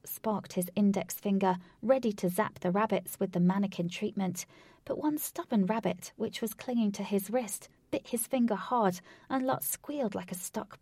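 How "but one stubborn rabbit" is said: ordinary delivery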